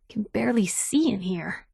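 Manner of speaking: soft whisper in the dark. Acted. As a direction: keep it hushed while sneaking. Sneaking through the dark.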